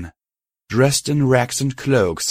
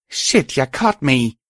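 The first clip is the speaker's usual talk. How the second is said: slow, higher creature voice; muttering Brahmin head; 5 semitones higher, slower than usual. A narrator's reading. Brahmin head owns up to the gang, muttering. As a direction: grumble it out slowly as the cow's head. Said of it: "the left head of the Brahmin muttered".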